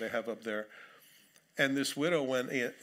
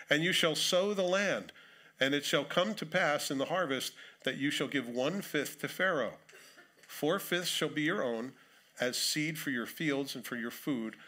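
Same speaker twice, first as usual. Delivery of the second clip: solemn public reading of scripture; 3 semitones higher than usual. Acted. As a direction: read the passage out with weight. Reads scripture aloud.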